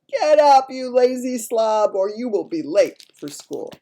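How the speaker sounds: loud, high holler, slowing then easing off; father's real voice. A narrator's reading. Father rousing his son for school, loud scolding. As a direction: bellow the wake-up, then settle slightly. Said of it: "my father's real voice saying".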